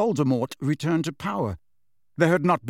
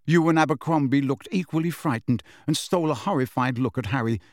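ordinary delivery

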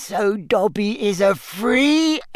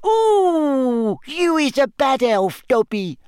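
high moaning elf; tearful elf lament; 8 semitones higher than usual. Acted. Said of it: "moaned Winky".

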